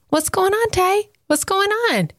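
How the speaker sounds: put-on mother checking on her baby; gentle parent voice. Asking toddler gently.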